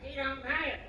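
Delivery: high mock mother voice insisting; boozy mom. Comic telling how Derry's mom denied it, high mock voice. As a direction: play the denying mom, pitched high. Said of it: "She'd be like".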